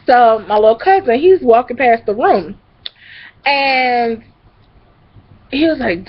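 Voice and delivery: usual speaking voice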